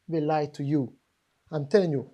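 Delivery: usual speaking voice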